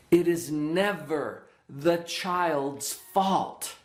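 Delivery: slow, weighty stress on never; spoken slowly, with every word weighted for a child; emphasized message. Shown as a demonstration. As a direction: lay each word down slowly so it lands. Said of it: "I really emphasize that".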